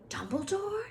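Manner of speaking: high, startled Dumbledore?; Harry startled by the news; surprised question. A narrator's reading. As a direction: gasp out the name in surprise.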